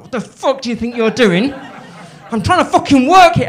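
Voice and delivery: mock on-set tantrum at full volume. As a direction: blow up at someone who walked into your eyeline.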